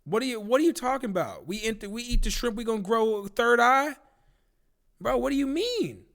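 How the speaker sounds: high-pitched, exasperated disbelief; incredulous protest. Acted. Streamer questioning a shrimp recall, incredulous protest. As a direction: protest as if it's absurd.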